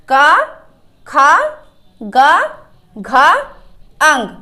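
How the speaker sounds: loud classroom recital, a pause after each letter; loud syllable recitation. Shown as a demonstration. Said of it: "एक बार फिर पढ़ते हैं".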